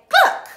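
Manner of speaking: very high, loud cluck; hen cluck. A narrator's reading. Hen hears of the plot, loud high clucking. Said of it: "the hen said".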